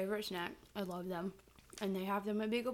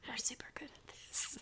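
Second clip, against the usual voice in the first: soft unvoiced whisper; ASMR whisper; 4 semitones higher, 7 dB quieter than usual. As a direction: whisper close to the mic.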